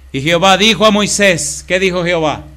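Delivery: loud, high, slow preacher's delivery; slow, booming preacher's reading; dramatic scripture reading. Acted. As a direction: thunder God's words to the people.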